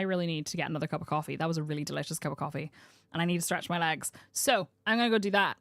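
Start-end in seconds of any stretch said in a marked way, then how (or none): none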